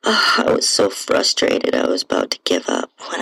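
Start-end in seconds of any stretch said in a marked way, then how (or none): none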